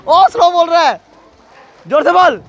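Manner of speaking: high loud call across the pool. Real, not acted.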